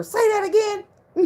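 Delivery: high, loud, giddy begging; swooning plea. Retold or imitated. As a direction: coo at him to say it again. Begging boyfriend to repeat.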